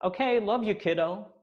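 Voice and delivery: high, bright offhand farewell; casual love you. Retold or imitated. Mother ending a phone call with her son, lightly affectionate. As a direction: toss it off lightly at the end of the call. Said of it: "she just kind of casually says".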